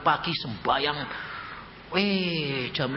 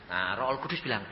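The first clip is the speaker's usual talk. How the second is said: ordinary talk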